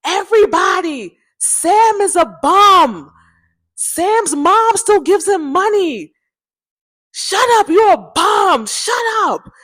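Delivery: high, loud mock screaming; hammed-up retold public yelling; imitated yelling. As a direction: scream it to the whole building. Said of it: "She just started yelling".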